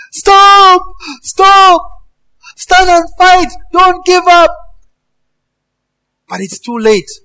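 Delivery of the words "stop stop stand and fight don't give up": very high, loud crying shout; desperate commander shout. Acted. Commander begging fleeing soldiers, high desperate shout. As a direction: scream them back into line. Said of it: "commanders are saying".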